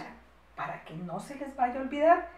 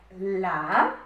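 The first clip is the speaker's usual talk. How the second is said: drawn-out word with rising end; slow dictation; 3 semitones lower, 3 dB louder than usual. Shown as a demonstration. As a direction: stretch it out for pupils writing.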